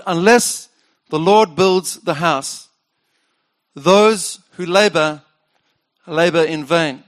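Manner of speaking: slow, weighty reading of the verse; slow solemn scripture. Shown as a demonstration. Preacher recalling the floor inscription, slow and solemn. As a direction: let each phrase land slowly. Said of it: "I wrote".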